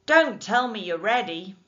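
loud call from a window above; loud shout from a warehouse window to the barge. A narrator's reading.

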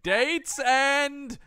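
high, loud bellowing announcement; hammed-up curfew cry; town crier shout. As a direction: call it out like a clock tower crier. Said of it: "It's like".

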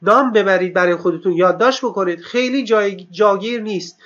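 ordinary talk, nothing marked

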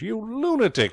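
very high, alarmed outburst; high-pitched exasperation; frantic protest. A narrator's reading. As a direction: exclaim at a madman in panic. George protests as boat plunges.

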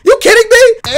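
high-pitched incredulous rant; mock outraged disbelief. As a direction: protest in a squeal of comic exasperation. Comic rants about useless taser.

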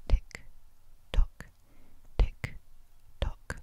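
whispered tick tock countdown; ticking clock. Acted. Counting down the answer time.

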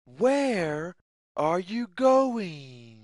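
plain reading voice

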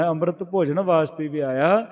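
ordinary delivery

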